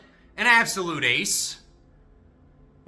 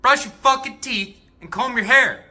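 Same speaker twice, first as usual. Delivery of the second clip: high, loud angry order; mom yelling; 8 semitones higher, 9 dB louder than usual. Retold or imitated. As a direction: snap the order at a lazy teen. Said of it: "she yells at me".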